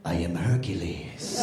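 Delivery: very deep, slow superhero voice; little boy as Hercules. Retold or imitated. Son playing Hercules answers Dad, very deep and solemn. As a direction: announce yourself as a mighty hero, far down low. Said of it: "pretending he was some superhero".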